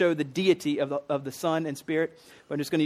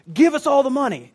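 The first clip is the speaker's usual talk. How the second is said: mock demand; 8 semitones higher, 7 dB louder than usual. Voicing Peter demanding money.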